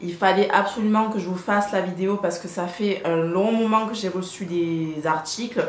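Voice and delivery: ordinary talk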